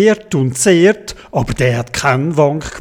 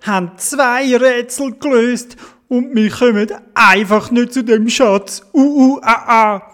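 high-pitched whiny little character; put-on high whinge; whining; 8 semitones higher than usual. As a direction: whine and moan in a high voice, ending in mock sobs. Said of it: "hat angefangen zu klönen".

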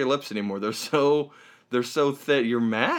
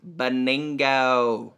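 drawn-out name; much slower than usual. Pretend. Host playing with a friend's name, drawn out. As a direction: savor BENINGO, stretching it very slowly.

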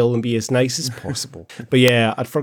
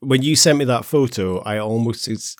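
ordinary delivery

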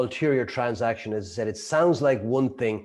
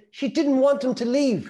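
much higher, mock-exasperated explaining; exasperated wife; 12 semitones higher than usual. Wife explaining the film to her clueless husband, higher and exasperated. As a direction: spell it out as if it's obvious.

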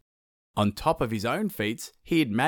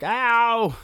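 sun in pain; 11 semitones higher than usual. Acted. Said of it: "Tamanui Tera was like".

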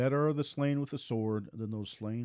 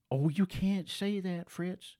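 higher, slow mock objection; mock critic; 8 semitones higher, slower than usual. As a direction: parody a shocked critic scolding him by name.

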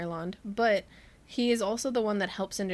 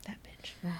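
whispered disgust; 2 semitones lower, 11 dB quieter than usual. Real, not acted. Disgusted muttered insult.

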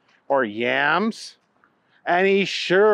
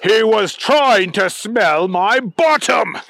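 loud, slow, raised-pitch outrage; affronted complaint, louder and higher; indignant; 6 semitones higher, 5 dB louder than usual. A narrator's reading. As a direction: protest loudly, drawing the words out. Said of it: "Neville glared at the girl".

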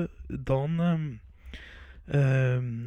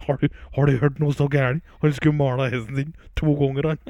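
laughing; 3 semitones higher, 5 dB louder than usual. Real, not acted. Laughing at his own joke.

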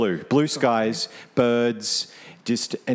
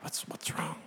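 whisper; 6 semitones higher, 16 dB quieter than usual. Pretend. Mock concern, whispered.

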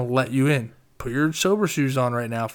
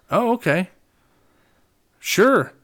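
sarcastic acceptance; 7 semitones higher, 3 dB louder, slower than usual. Retold or imitated. Mock acceptance of friend's excuse.

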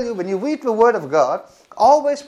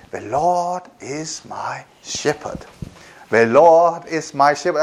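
calm recitation; 5 semitones lower, slower than usual. Shown as a demonstration. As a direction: speak it gently to calm yourself. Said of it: "I just say".

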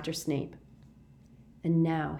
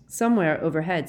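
usual speaking voice

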